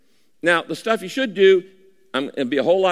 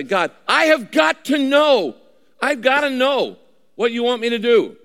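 high, loud, pleading prayer; urgent pleading shout to God; desperate cry to God; 8 semitones higher, 4 dB louder than usual. Pretend. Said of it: "when you cry out to God".

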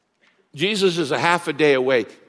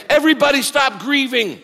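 higher, louder hammed-up bragging; mock triumphant announcement; mock boastful shout; 10 semitones higher, 5 dB louder than usual. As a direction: play a show-off Jesus calling out. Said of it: "He doesn't say".

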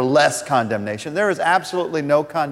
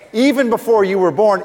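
usual speaking voice

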